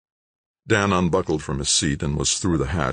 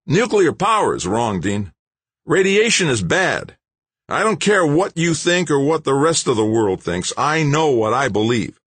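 raised, insistent true believer; stubborn protester; 11 semitones higher, 5 dB louder than usual. Acted. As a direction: declare it as an unshakable creed. Don stubbornly states belief.

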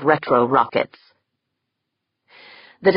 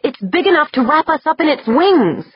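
alarmed cry; 12 semitones higher, 3 dB louder than usual. A narrator's reading. Corvus continues alarmed.

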